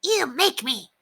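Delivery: high, defiant dog voice; Ribsy. A narrator's reading. Dog dares the Dalmatian, high and defiant. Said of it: "Ribsy told Ranger".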